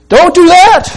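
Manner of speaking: high, loud angelic command; booming angel. Acted. Angel forbidding John to bow, loud and high. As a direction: proclaim it from heaven.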